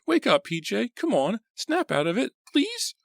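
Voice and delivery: high, desperate pleading; very high panicked plea; frantic begging. A narrator's reading. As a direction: beg him frantically to wake. Artie begging PJ to wake.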